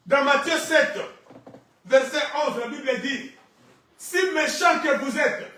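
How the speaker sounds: forceful preaching. Real, not acted. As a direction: proclaim it loudly, stretching key words.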